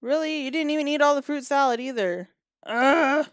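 loud, high, fast exasperated scolding; loud fed-up rant ending in a groan; exasperated outburst. Pretend. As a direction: throw up your hands at the Sim, then groan. Outraged at the Sim's mess.

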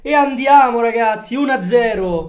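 shouted, stretched play-by-play; breathless italian goal commentary. Real, not acted. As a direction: call the goal like a live commentator.

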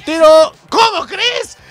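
loud call leaping to a very high yell; disbelieving shout. Real, not acted. Commentator watching his team's penalty, high disbelieving shout. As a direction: jump up into a shriek of disbelief. Another penalty shot missed.

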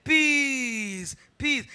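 high, loud drawn-out call, fading; the Lord commanding peace. Retold or imitated. Preacher voicing the Lord's command of peace, shouted. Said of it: "And the Lord said".

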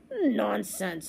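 scornful snort of a word. A narrator's reading. Captain rejects the boy's warning, snorting. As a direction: snort it dismissively. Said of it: "snorted the captain".

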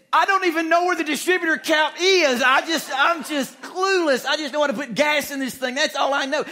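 high, loud, exasperated wife voice; wife protesting she knows nothing about cars; wife's flustered outburst. Retold or imitated. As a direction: play her half-shouting that she's clueless. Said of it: "she said".

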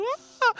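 retold scream. Sister screaming at finding rifle.

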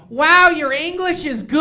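slow, loud, patronising praise; overly impressed, talking down slowly; condescending compliment; mock impressed tourist. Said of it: "and they go".

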